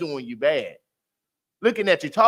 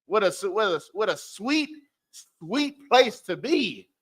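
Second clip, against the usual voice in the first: dreamy praise; 4 semitones higher, slower than usual. Real, not acted. Praising a peaceful home.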